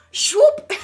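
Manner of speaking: high, loud swooping sound with a laugh; whooshing swoop. Retold or imitated. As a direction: imitate the dots flying in. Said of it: "so they're just like".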